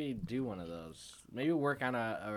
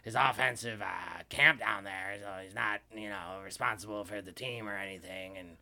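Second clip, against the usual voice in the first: sports radio host; 8 dB louder than usual. Acted. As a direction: play a gruff sports talk radio host. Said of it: "That was really good".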